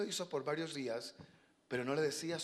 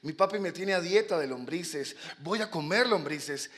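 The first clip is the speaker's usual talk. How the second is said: put-on higher, childlike storybook delight; eager young swallow; 4 semitones higher, 5 dB louder, slower than usual. Young swallow hearing of worms for sale, thrilled. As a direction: gush like a hungry fledgling.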